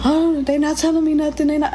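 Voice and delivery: a partial mock scream of distress; retold screaming. Cousin on the phone from downstairs, high frantic cry. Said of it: "She's, like, screaming".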